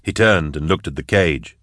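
usual speaking voice

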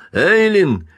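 high, loud urgent shout; hoarse call. A narrator's reading. Husband calls unanswering wife, hoarse urgent shout. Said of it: "хрипло позвал Каупервуд".

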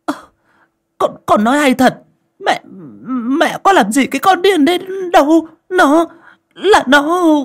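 put-on very high, loud, defiant old woman's voice; mother stubbornly answering her son. A narrator's reading. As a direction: dig in and argue back, shrill with denial. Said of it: "Bà Báu vẫn cứ gân cổ".

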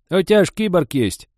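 high, loud brazen reminder; shameless. Acted. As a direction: remind him without a shred of shame. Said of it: "так беззастенчиво напомнила старуха".

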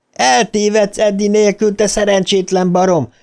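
high, exasperated yelling; sustained high-pitched angry calling; shouting. A narrator's reading. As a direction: holler after a runaway friend, drawing out the pleas. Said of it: "újra kiabálni kezdett".

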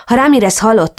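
ordinary delivery, nothing marked

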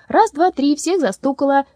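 high, loud triumphant call; gleeful. Acted. Lyolya catching the women in the game, gleeful and loud. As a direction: crow with joy. Child winning hide-and-seek.

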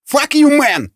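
very high-pitched angry squawk; yelled retort. A narrator's reading. Second man back at the guard, yelled retort. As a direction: yelp it back in outrage. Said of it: "завопил на него второй".

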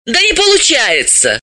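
high-pitched brush-off; impatient dismissal; dismissive. A narrator's reading. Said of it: "отмахнулась Далила".

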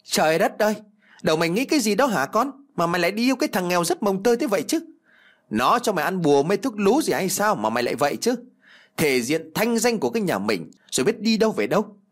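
high-pitched, rapid tirade of an outraged mother; scolding mother. Acted. Mother berates daughter over the poor lover, shrill scolding. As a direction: berate her daughter in a rush.

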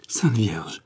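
breathless whispered oath; trembling whisper. A narrator's reading. Mother overwhelmed by the news, trembling whisper. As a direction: gasp it under your breath, shaken. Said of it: "toute tremblante".

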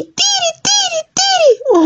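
shrill, very high bird cry of alarm; tero bird call. Acted. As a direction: squeal it like a startled lapwing. Tero bird startles Elena.

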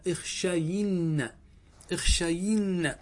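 "اخشين" very slow, with a drawn-out "yi"; kasra on the ya. Shown as a demonstration. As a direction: stretch "اخشين" to show the kasra on the ya. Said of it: "كسرنا الياء فقلنا". (نطق «اخشين» ببطء مع إبراز كسرة الياء)

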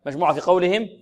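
usual speaking voice

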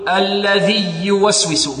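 الذي يوسوس with the two ي fused into a doubled y; faulty idgham joining الذي to يوسوس; wrongly merged. Shown as a demonstration. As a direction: say alladhiy-yuwaswisu, pressing the ي. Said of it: "يدغم".

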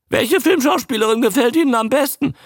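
very high, loud squawky creature; loud, high, quick croak; croaking newt. A narrator's reading. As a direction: introduce yourself as an eager talking newt. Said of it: "krächzte er".

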